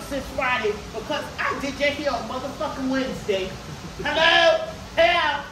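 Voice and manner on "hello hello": loud, sharp call of hello. Retold or imitated. Stylist calling out to rude customer, loud and fed up.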